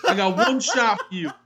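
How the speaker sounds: high, hammed-up wise guy threat; mock mobster warning; Jersey tough guy. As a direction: threaten Paulie in a put-on Jersey voice. Jersey tough guy bit continues.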